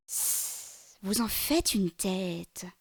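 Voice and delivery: hissing, slow serpent voice; a slow sly serpent hissing at his neighbour; snake monster. Acted. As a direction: hiss at the start, then drag the words. Snake-headed monster speaks.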